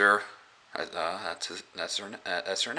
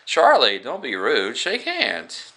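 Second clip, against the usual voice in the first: higher, chiding mom voice; a mother scolding her son, pitched up; 5 semitones higher, 4 dB louder, slower than usual. Retold or imitated. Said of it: "he says".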